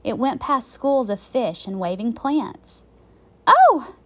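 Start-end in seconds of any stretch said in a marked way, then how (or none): 3.5-3.9 loud, high burst of "oh"; startled gasp. A narrator's reading. Girl spots eyes behind a sea plant, startled gasp. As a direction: gasp it out in sudden shock. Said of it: "gasped Annie".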